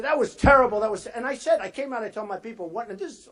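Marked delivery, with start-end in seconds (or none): 0.0-1.1 loud, emphatic quoted complaint; mock-indignant loud quote; retold complaint. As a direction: hammer the word terrible as you quote him. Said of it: "he said".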